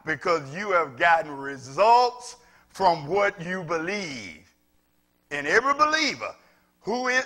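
high, loud impassioned preaching; whooping pulpit preacher; full-force preaching. Acted. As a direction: drive it home to the congregation.

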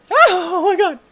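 high, loud shriek of alarm; panicked scream. Real, not acted. Gamer attacked by a spider, panicked scream. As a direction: scream as the creature strikes.

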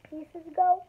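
usual speaking voice

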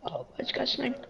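urgent whispered warning. Real, not acted. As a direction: hiss the warning under breath. Warning teammate under fire.

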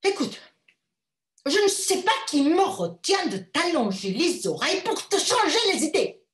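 angry threat. A narrator's reading. Furious mother threatens her daughter, angry and loud. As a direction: threaten the girl sharply. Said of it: "continua-t-elle sur le même ton".

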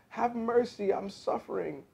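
high-pitched, pitiful pleading; acted wail. A narrator's reading. Suffering fox begging the dentist for help, pitiful wail. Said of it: "the fox wailed".

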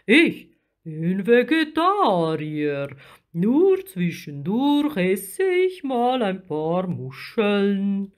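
slow, big creature voice, affronted; proudly protest, vowels drawn out; offended sea giant; huffy giant octopus. A narrator's reading. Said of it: "Der Krake verknotete ärgerlich".

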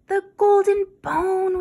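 usual speaking voice

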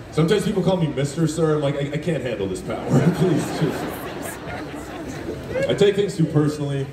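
normal talking voice

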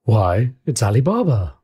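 a touch of rapture in the cry; ecstatic exclamation. Acted. Scrooge spots Ali Baba at the window, ecstatic. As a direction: burst out with delighted recognition, fairly loud. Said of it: "Scrooge exclaimed in ecstasy".

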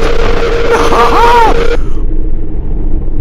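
loud, high burst of startled laughter; high shrieking laugh. Real, not acted. Player just after a jump scare, high shrieking laugh.

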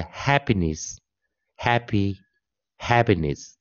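"happiness, happy, happiness" slowly, stress on hap; teacher modelling happy versus happiness; slow model pronunciation. Shown as a demonstration. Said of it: "就是这样子来念哦".

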